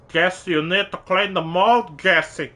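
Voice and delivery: a hint of a pushy matchmaker auntie; auntie impression. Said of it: "That's my auntie impression".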